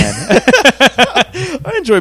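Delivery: laughing. Real, not acted. Laughing through own line.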